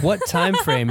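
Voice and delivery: lightly put-on Minnesota lady accent. Husband mimicking wife's questioning in Minnesota accent. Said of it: "like, a Minnesota lady".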